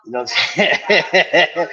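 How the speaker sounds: slow words dissolving into laughter; amused, laughing tag question. Real, not acted. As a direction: chuckle through the question. Laughs after telling his story.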